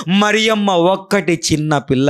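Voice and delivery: usual speaking voice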